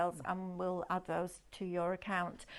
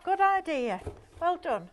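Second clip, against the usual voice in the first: excited praise; 9 semitones higher, 6 dB louder than usual. Real, not acted. Praising cohost's clever idea.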